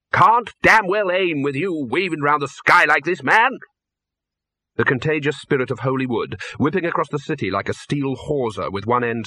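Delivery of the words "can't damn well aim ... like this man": angry shout. Acted. Arch-Chancellor angry aiming.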